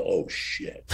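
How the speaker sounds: breathy low whisper of shock; a retold hushed curse, dropped low; whispered oh shit. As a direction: hiss it under your breath, caught off guard. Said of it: "Like that".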